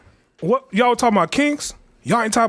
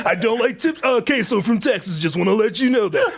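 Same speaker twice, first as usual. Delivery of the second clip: higher, laid-back bro voice; mock Sammy impression, higher voice; 4 semitones higher than usual. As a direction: play him as a chill California dude, pitched up. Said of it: "This is his impression".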